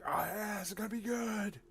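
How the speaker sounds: high, eager anticipation; onlookers expecting. Acted. The crowd watching Barth roll, high eager anticipation. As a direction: hype up the roll. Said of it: "Everybody's looking at you like".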